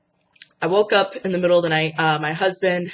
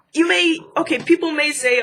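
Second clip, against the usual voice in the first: high and eager; excited; 9 semitones higher than usual. Real, not acted. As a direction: keep the excitement going. Worked up, addressing viewers.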